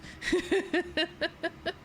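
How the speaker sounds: a little laugh at yourself; small giggle. Real, not acted. Laughs at being poor.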